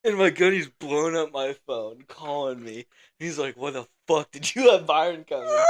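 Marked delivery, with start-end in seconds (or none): 3.6-5.6 raised, higher-pitched retelling of a chewing-out; mock an angry superior's demand; irate gunny. Gunny chewing out a corporal by phone, irate.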